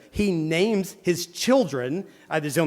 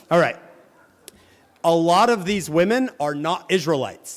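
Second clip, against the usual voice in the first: usual speaking voice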